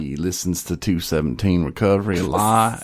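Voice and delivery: drawling Southern storyteller. Acted. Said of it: "he does pretty good".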